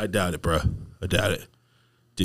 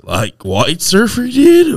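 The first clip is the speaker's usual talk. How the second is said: a slow, louder laid-back surfer drawl ending high; surfer dude; 13 semitones higher, 8 dB louder, much slower than usual. Pretend. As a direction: stretch it like a surfer bro. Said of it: "you can do surfer".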